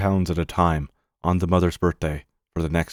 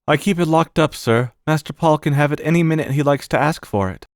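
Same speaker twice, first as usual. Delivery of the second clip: respectful servant, higher-pitched voice; Bassett; 8 semitones higher than usual. Acted. Servant accounting to the uncle for the money, high and respectful. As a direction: answer up like a loyal servant.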